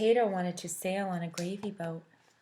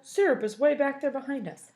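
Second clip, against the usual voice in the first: higher, louder correction; Waffle's retort; 6 semitones higher, 6 dB louder than usual. Acted. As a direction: point it out indignantly. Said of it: "said Waffle".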